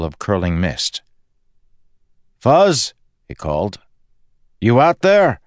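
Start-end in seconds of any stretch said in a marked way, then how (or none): 2.4-2.9 loud call into the mist; high, loud call into the mist. Acted. Calling out into the mist. 4.6-5.4 loud call into the mist; high, loud call into the mist. Acted. Calling out into the mist.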